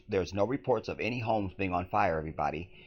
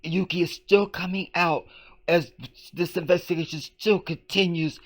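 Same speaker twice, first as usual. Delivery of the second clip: high-pitched, halting parody of a newsreader; mock reporter tone; 8 semitones higher than usual. Host imitates the reporter's tone, high mock newscast. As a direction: put on her tone, pitched way up. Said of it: "Hear her tone?".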